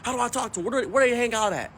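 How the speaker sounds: mock granddad firing off questions; excited granddad. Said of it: "He said".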